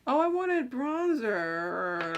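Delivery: ordinary talk, nothing marked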